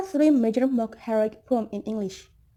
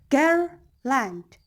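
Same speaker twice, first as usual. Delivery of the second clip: put-on "girl, land" split, with "girl" higher and stronger; stress on first syllable; 3 semitones higher than usual. Shown as a demonstration. Teacher showing trochaic pattern, stressed "girl" before falling "land". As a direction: punch "girl", pause, let "land" drop. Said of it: "that's stressed syllable".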